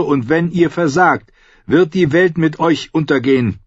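a priestess urging her king toward fate; Melisandre prophesying. Acted. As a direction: proclaim his destiny like a fervent prophetess.

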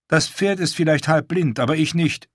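a lightly voiced proud wildling woman; Val's voice.

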